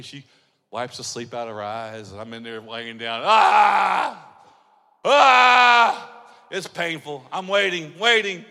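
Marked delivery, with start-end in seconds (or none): 3.2-6.2 loud, mock pained yell; hammed-up cry of a popped shoulder; the husband crying out in pain on the bed.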